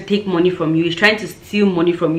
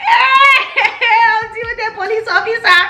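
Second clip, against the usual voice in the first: very high, drawn-out squeal; comic high-pitched cry; self-mocking outburst; slower than usual. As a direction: wail it high in mock bravado. Mimicking wail over her own bravado.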